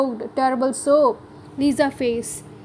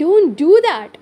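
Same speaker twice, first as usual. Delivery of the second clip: higher, louder command to stop; urgent shout; 5 semitones higher, 7 dB louder than usual. A narrator's reading. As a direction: shout to stop her now. Stopping Lisa's attack.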